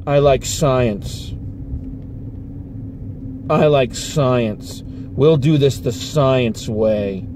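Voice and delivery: low, slow, expressionless monotone; stone-faced girl. Retold or imitated. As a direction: imitate a kid with no emotion at all, slow and deadpan. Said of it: "Stone-faced".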